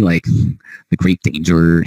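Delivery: usual speaking voice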